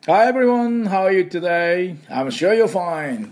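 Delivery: high, drawn-out sing-out greeting; show-opening greeting. Acted. Host opening the English lesson for everyone, high drawn-out greeting. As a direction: stretch each phrase out high and slow.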